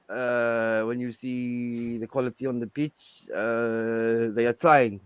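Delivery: put-on low, hesitant football manager's accent; low, halting press-conference impression; Poch. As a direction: answer slowly and softly, full of ums. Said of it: "Can you do a Poch impression?".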